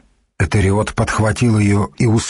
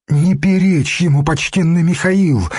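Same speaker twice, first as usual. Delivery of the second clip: pleading; 7 semitones higher than usual. A narrator's reading. Abbess begging Mikhail not to fight, high pleading. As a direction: beg him to stop, voice lifted high. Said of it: "взмолилась игуменья".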